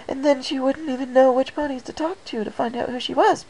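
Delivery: high, rushing babble; sputtering Pinkie. Acted. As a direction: sputter it out in one breath. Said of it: "Pinkie Pie sputtered".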